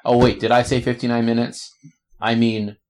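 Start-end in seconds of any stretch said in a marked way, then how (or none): none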